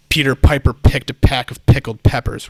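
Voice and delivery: "Peter Piper picked a pack of pickled peppers" with every P popped loud; over-enunciated plosives. Shown as a demonstration. Host demonstrates bad mic technique, over-enunciating every P. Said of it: "if I'm really enunciating".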